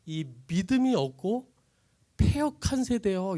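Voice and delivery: slow, weighty lament; mournful rebuke. Acted. Jesus lamenting the faithless generation, slow rebuke. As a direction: draw out each word in sorrow.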